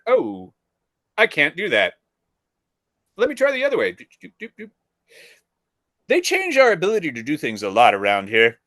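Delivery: mock apologetic officer; imitating the officer. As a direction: play the helpless clerk. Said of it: "He's like".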